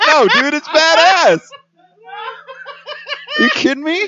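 excited laughing. Real, not acted. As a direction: gush about it, high. Excited about discovery.

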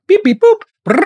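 high-pitched beeps and boops, then a trill; imitated phone dialling. Mimics dialling a call to HR.

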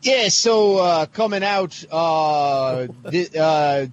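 put-on Australian accent with a rising lilt; a better go at the Australian accent. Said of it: "That is way better".

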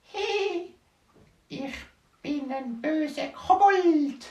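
very high, slow creepy goblin voice; evil goblin voice. Acted. Dwarf retelling the goblin at his door, in a high slow goblin voice. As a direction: squeak it out slowly like a wicked little goblin. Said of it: "Und der hat gesagt".

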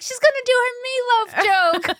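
high, hammed-up anticipation; high-pitched pretend fan excitement; mock thrilled fans. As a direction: imitate an audience giddy for a hit. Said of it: "they're like".

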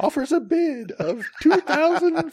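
very high, slow crier announcement; town crier. Retold or imitated. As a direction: cry the name out like a herald. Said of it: "it's like an old eighteenth century".

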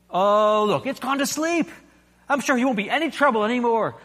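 high, flustered ghost voice; the anxious ghost, voice jumping up; nervous ghost. A narrator's reading. Ghost pleads.

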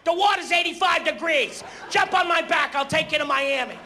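high, loud, frantic shouting; excited idiot. Retold or imitated. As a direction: hype everyone up like a crazed passenger. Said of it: "One guy's running around".

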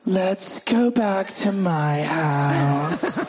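very slow, lazy come-on; seductive woman. Retold or imitated. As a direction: drawl the invitation slowly. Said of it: "the twenty-five-year-old cop's like".

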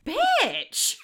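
high mock shriek of bitch; shrieked insult. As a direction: shriek it like a sassy comeback. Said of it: "They were saying how wonderful".

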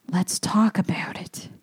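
hushed conspiratorial whisper. Real, not acted. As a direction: drop to a secret whisper. Conspiratorial aside to listener.

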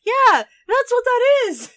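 loud, high burst of delighted recognition; real high-pitched outburst; excited agreement. Real, not acted. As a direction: exclaim it, cracking up. Delighted recognition after the joke.

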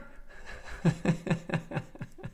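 short breathy chuckle; laugh. Real, not acted. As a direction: laugh softly at your own joke. His own laugh after the joke.

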